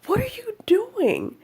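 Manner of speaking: very high, squeaky mock wail; dog's imagined protest. Dog protesting to owner over insulin shot, mock wail. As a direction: voice an indignant little dog way up high. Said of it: "it's always like".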